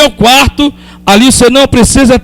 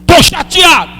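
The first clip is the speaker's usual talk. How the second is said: higher, strained cry of being upset; anguished cry; 7 semitones higher than usual. Pretend. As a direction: cry it out to God. Shouted then repeated quietly.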